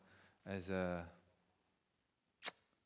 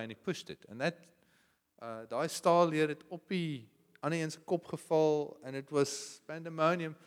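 ordinary delivery

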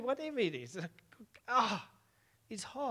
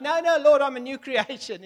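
protesting cry; 5 semitones higher, 9 dB louder than usual. Acted. Preacher acting out protesting believer.

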